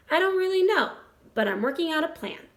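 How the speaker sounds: plain reading voice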